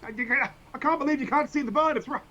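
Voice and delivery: can't believe nobody else sees it; incredulous. Acted. Character stunned the human ignores the bird, high and loud disbelief.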